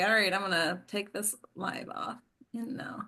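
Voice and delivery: ordinary talk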